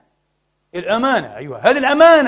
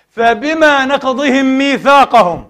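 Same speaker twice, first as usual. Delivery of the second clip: slow emphatic recitation; 5 semitones higher, 6 dB louder, much slower than usual. Acted. Recites the verse slowly.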